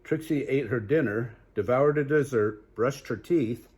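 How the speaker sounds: lively storybook read-aloud; animated bedtime story voice; reading to children. Acted. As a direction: read it bright for little listeners. Reads picture book aloud.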